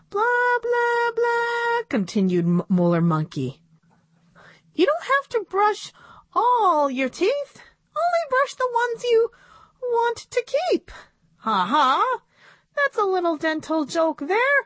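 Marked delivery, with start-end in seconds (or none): 0.1-1.8 very high puppet voice droning on; high, monotonous puppet blah-blah; monkey puppet. A narrator's reading. 4.7-10.9 high puppet voice setting up a joke; high-voiced puppet comedian; monkey puppet. A narrator's reading. 11.4-14.6 high puppet voice with a mock laugh; high, self-amused puppet comedian; monkey puppet. A narrator's reading. Puppet monkey laughs at own joke.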